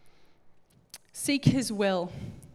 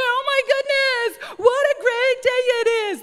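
much higher, louder, beaming sing-song greeting; overly cheery; 14 semitones higher, 8 dB louder than usual. Pretend. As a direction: gush about the day like a shiny morning person. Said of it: "you go".

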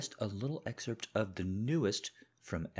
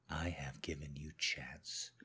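softer, whispered warning; threatening whisper; 5 semitones lower, 8 dB quieter, slower than usual. Acted. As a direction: breathe the warning out. Husband's quiet threat.

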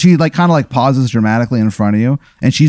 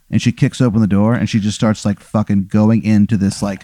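usual speaking voice

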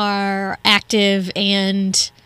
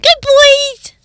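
higher, louder, slow gushing greeting; dog talking voice; 9 semitones higher, 7 dB louder, slower than usual. Retold or imitated. [name] greeting her dogs in excited pet-talk. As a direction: greet the dogs like they're the best. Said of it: "I'm like".

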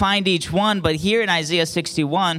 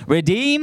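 REDEEM with a high rising second syllable; raised, drawn-up REDEEM, inviting repetition; call-and-response; 7 semitones higher than usual. Real, not acted. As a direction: call out "Redeem" for the crowd to echo, lifting high on "deem". Leading congregation to repeat words.

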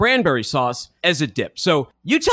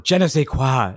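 French phrase; 3 semitones lower than usual. Retold or imitated. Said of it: "the Louisianans would say in their French".

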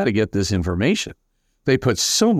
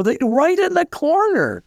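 high-pitched imitation of a child pointing something out; insistent child. Dying child showing adults unseen visitors, high insistent voice. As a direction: act out a kid's earnest insistence.

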